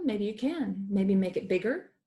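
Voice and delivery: ordinary talk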